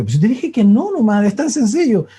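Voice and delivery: retold brusqueness, only slightly forceful; retold sharp reply. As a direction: act out a husband's impatient answer. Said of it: "me hablaste fuerte".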